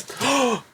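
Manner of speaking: sudden high gasp of alarm; a startled intake of breath; sharp gasp. Real, not acted. Gasping as the model nearly falls off the stand.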